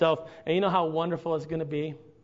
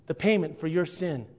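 ordinary talk, nothing marked

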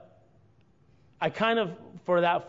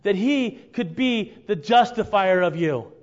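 louder, higher building preacher's cry; preaching climax; 7 semitones higher, 7 dB louder, slower than usual. Real, not acted. Preacher builds to his peak, telling the church of Christ in the gap. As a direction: lift the room to the peak.

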